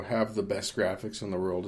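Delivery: ordinary talk, nothing marked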